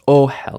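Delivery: Ohel with strong O and unstressed second syllable; initial stress. Shown as a demonstration. Teacher showing the unstressed vowel, initial stress on Ohel. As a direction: hit the O of Ohel, ease off HEL. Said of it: "going to be unstressed".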